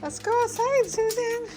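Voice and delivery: high sing-song call to the dog; pet-talk voice. Real, not acted. Calling dog to go out.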